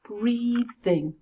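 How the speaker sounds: Breathing in two clear beats, BREA-thing; high, held first half of Breathing, then "thing"; syllable by syllable. Shown as a demonstration. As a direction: count out both syllables of Breathing. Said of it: "How many syllables?".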